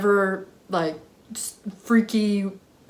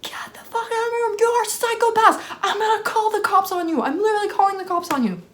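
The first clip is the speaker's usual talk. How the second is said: high strained scream, held down in volume; retold screaming; 12 semitones higher than usual. Woman threatening intruders with the cops, mock screaming. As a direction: act out screeching threats without full loudness. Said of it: "screaming at the top of my lungs".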